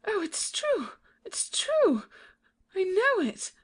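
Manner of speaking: high, crying realization of the truth; horrified cry. Acted. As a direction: break into a frightened cry on true. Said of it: "with a cry springs from him".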